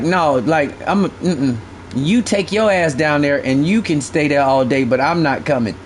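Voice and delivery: low, lazy mock girlfriend voice; a lightly voiced dismissive girlfriend; imitating his old lady. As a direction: mimic her waving it off. Old lady quoted refusing fishing.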